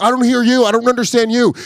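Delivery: high, exasperated phone complaint; acting out a fed-up caller; retold anger. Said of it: "I'm so mad".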